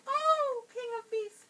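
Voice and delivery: high, squeaky little mouse voice; tiny frightened mouse squeaking. A narrator's reading. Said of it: "squeaked the little mouse".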